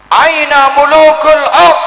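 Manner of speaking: high, slow, loud summons; booming divine call. Acted. Preacher booming God's call to the kings. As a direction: call out like God demanding the kings.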